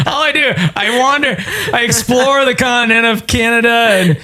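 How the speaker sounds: high, fed-up explorer voice; whiny, tired explorer; weary explorer. Acted.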